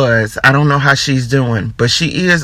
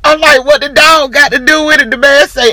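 high, cracking voice through laughter; laughing while talking; 9 semitones higher than usual. Real, not acted. Host retelling the video while still laughing, high voice. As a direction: talk through the giggles, voice up high. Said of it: "I should not be laughing this hard".

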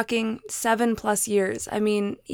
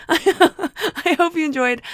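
high, slow, through a laugh; 6 semitones higher, 4 dB louder, slower than usual. Shown as a demonstration. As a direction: giggle the words out. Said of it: "I hope you enjoyed my deep, sultry voice".

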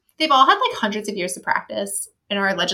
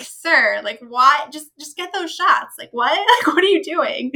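higher-pitched, baffled protest; exasperated disbelief; 5 semitones higher, 4 dB louder than usual. Retold or imitated. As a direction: plead with him, half laughing, totally baffled. Exasperated reaction to the character.

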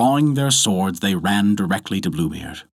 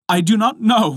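high, louder protest; frightened cry; 13 semitones higher, 5 dB louder than usual. A narrator's reading. Terrified wife answering her husband, crying out. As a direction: cry out a denial in a raised pitch. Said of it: "cried the poor woman".

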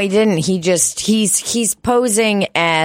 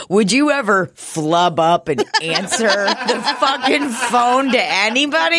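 laughing; 4 semitones higher, slower than usual. Real, not acted. Laughing while teasing.

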